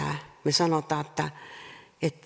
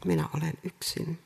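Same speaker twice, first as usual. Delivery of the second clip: softer, distant lonely voice; lonely hush; 4 dB quieter than usual. Acted. Preacher voicing a lonely person to the congregation, softly. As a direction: let it fall away, alone.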